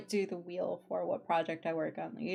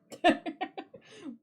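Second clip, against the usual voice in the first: bright laugh; 10 semitones higher, 5 dB louder than usual. Real, not acted. Amused at own idea.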